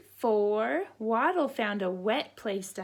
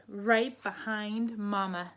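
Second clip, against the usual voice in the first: ordinary delivery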